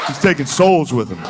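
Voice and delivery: normal talking voice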